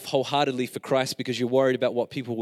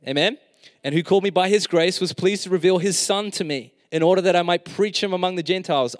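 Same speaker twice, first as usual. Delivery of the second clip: loud, high scripture reading; fervent preaching; 5 semitones higher, 5 dB louder than usual. Real, not acted. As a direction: preach the verses with full force. Reading scripture at full force.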